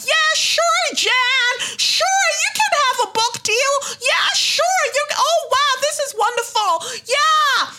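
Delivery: high, loud sing-song mock voice; sarcastic high-pitched impression of a cheery publisher. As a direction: ham up a gushing fake-nice publisher.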